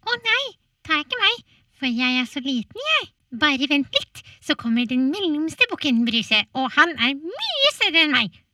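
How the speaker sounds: high, loud pleading goat; little goat voice. Acted. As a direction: beg in a squeaky panic. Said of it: "sa bukken".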